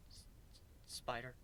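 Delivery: barely audible frightened murmur; a scared, near-silent question; too-quiet squeak. Acted. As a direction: say it so softly he can't hear. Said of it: "You gotta speak up".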